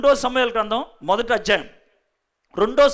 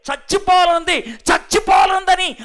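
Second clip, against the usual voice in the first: mock shout, higher and louder; a raised cry of pain, acted; retold scream; 6 semitones higher, 5 dB louder than usual. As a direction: yell it out as the dying king. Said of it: "అరుస్తున్నాడు".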